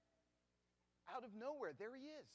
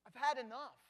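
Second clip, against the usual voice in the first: exasperated outburst; 5 semitones higher, 7 dB louder than usual. Retold or imitated. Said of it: "I said".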